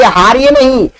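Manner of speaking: plain reading voice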